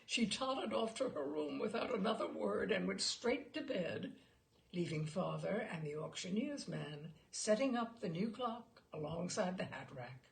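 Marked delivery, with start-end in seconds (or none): none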